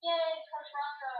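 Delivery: high, slow mock cheer. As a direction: cheer it like an eager rookie. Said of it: "this is me like".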